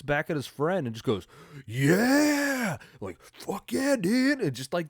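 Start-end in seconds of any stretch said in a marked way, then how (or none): 1.7-4.4 high, strained hyped-up yell; mock-ecstatic whoop, way up in pitch; stoked dude. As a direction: imitate a stoked bro's drawn-out cheer. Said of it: "that was just stoked dudes".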